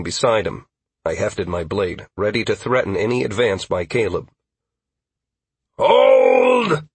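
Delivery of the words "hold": long, high, loud shout; one sustained commanding yell; shouted halt. Acted. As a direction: bellow a halt to the whole field. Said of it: "Aldwin shouted".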